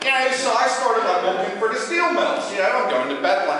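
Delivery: put-on loud Pittsburgh yinzer accent; Pittsburgh shepherd. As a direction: gripe like a local bus rider.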